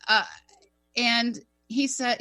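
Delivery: ordinary talk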